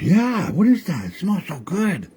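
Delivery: high, eager character voice; excited high-pitched animal voice; Wagush voice. Acted. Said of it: "And he goes".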